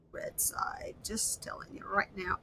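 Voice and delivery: breathy whispered aside, very soft. Real, not acted. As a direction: whisper it as a secret to the viewers.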